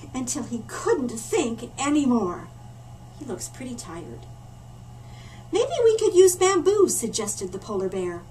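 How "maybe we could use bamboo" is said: hopeful suggestion. Acted. Polar bear suggests idea.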